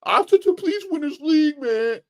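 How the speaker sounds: very high, loud mock begging; mock pleading. Imitating fans begging Arteta for the league, mock pleading.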